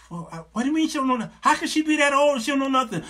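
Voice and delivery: fast, high-pitched mock disbelief; incredulous questioning. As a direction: fire back a baffled question. Acts puzzled counselor questioning the man.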